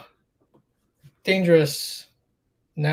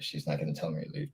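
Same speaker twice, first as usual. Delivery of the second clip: much lower and softer, hurried, half to himself; quiet quick aside; 10 semitones lower, 12 dB quieter, faster than usual. Real, not acted. Repeating to himself about his mom, quiet rushed aside. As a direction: drop it under your breath, quickly.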